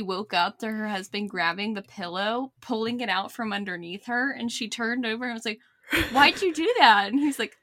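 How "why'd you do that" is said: high, loud acted protest; retold startled complaint; indignant. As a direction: challenge a pillow thief on waking. Retelling wife woken by husband.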